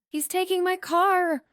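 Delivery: high wailing cry. A narrator's reading. Sister wailing in stairwell over her car. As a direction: wail it out in distress. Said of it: "Olivia wailed".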